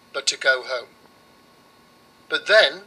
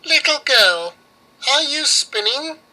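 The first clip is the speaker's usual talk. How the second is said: high, louder witch calling in; 9 semitones higher, 4 dB louder than usual. Acted. Baba Yaga calls through the window, loud. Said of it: "came to the window and asked".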